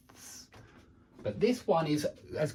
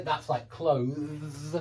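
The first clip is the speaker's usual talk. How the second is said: ordinary talk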